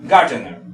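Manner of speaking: Gardener, hit at the start and dropping low; low, falling Gardener, front-stressed; stress on first syllable. Shown as a demonstration. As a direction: punch the opening of Gardener, then fall. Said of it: "Mayor fuerza de voz".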